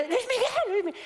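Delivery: high shrill call to a celebrity; fan yelling. Retold or imitated. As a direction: call out his name like a frantic fan.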